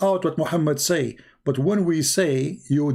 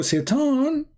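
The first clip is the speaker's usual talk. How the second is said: ominous; 12 semitones higher than usual. Acted. Dramatic naming of the devil.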